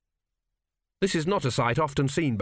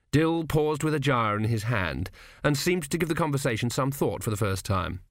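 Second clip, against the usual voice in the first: ordinary delivery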